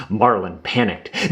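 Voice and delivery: ordinary delivery, nothing marked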